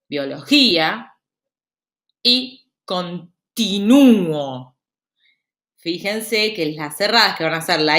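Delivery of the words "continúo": CONTINÚO very slow, the Ú held long; stressed closed vowel. Shown as a demonstration. Teacher giving hiatus examples, stressed Ú in CONTINÚO. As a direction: break up CONTINÚO and lean hard on the Ú. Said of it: "en la vocal cerrada cae la acentuación".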